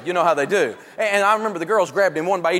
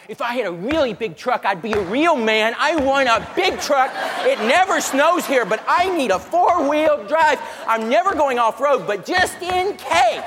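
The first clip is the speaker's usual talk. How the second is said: whiny big guy; 5 semitones higher than usual. Retold or imitated. Big linebacker type whining for a truck, childish. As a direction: beg like a kid for a toy truck. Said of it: "standing by the four-wheel drives going".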